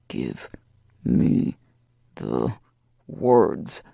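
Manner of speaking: very low, every word split apart and punched; each word driven home. A narrator's reading. Lover punctuating each word with a smack, low and separated. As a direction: hammer out each word with a pause between. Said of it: "drives each word home".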